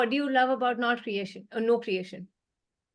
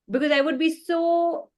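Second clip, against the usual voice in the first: ordinary talk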